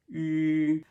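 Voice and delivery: U as a held "ee" through rounded lips; French U sound. Shown as a demonstration. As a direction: purse the lips and sustain U. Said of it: "shape your mouth like this".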